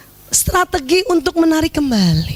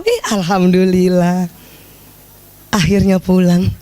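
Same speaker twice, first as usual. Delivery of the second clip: sweet welcome; 9 semitones lower than usual. Acted. Wife greets husband sweetly.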